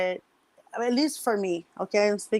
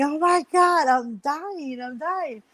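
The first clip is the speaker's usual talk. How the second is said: high mock cry that fades; retold screaming; 6 semitones higher, slower than usual. Screaming to her sister from the shower, mock panic. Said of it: "I started screaming".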